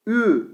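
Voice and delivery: teacher models the thin Ü for pupils, slow and clear; thin Ü sound. Shown as a demonstration. Quran teacher demonstrates thin vowel Ü, drawn out. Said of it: "ince ise".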